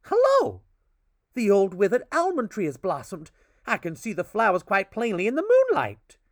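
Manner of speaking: high, loud thrilled call; excited exclaiming. A narrator's reading. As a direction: burst out in wonder. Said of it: "exclaimed one of the twins".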